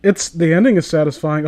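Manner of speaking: ordinary talk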